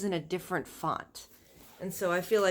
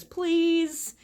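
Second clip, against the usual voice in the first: pleading; 10 semitones higher, 5 dB louder than usual. Acted. Husband pleads with his wife.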